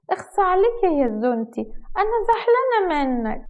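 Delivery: slow, high-pitched, put-on sulky voice; coquettish pouting. A narrator's reading. Wife plays seductress to husband, coquettish pout. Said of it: "هتلعب عليه دور حواء".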